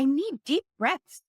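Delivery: high, rising little-kid voice, mildly played; imitating her toddler. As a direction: pipe up like a three-year-old asking for help. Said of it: "she's just like".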